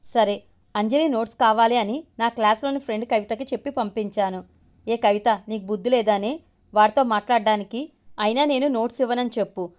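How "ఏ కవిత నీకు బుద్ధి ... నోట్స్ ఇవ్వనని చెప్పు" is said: ordinary delivery, nothing marked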